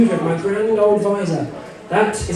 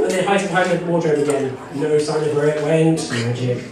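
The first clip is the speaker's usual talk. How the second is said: usual speaking voice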